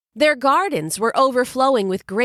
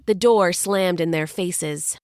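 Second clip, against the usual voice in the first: ordinary delivery, nothing marked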